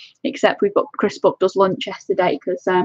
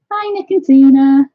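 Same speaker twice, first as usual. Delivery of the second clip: louder, slow welcoming call; cheery greeting; 5 semitones higher, 8 dB louder, slower than usual. Real, not acted. Welcoming viewers into her live chat, a loud, slow hello. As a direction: call out hello to arriving friends.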